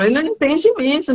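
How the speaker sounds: emphatic insistence. Retold or imitated. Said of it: "Eu falo".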